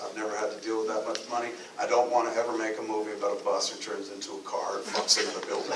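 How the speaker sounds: normal talking voice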